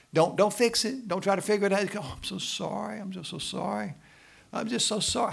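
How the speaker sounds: high, soothing mock sympathy; comforting husband. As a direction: play the husband who just comforts. Pastor acts comforting a crying wife.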